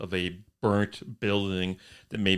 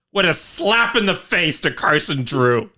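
loud, raised-pitch outrage; ranting on someone's behalf; indignant rant; 7 semitones higher, 7 dB louder than usual. Real, not acted. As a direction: punch it out in comic indignation. Indignant rant, admits "I can rant.

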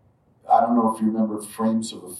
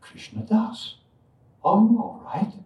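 much higher, a lightly voiced old lady; old devotee asking; 12 semitones higher than usual. Missus Soni stops him in the courtyard, higher worried old lady. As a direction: ask kindly like an elderly devotee. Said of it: "she said".

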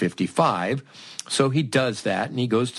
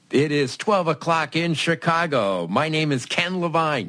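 radio DJ announce; 7 semitones higher than usual. Pretend. Going on air as DJ.

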